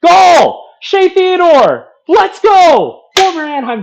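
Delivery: screaming goal, high and loud; goal celebration shout. Real, not acted. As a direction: yell it out for the goal.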